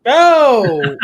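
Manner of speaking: loud, high drawn-out "oh"; excited outcry. Real, not acted. Author reacts as the awaited question comes, excited outcry. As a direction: cry out long, high and loud.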